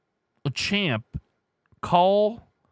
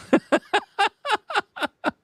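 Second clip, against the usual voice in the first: long, high-pitched giggling laugh. Real, not acted. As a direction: burst into high, real giggles. Amused by own bong remark.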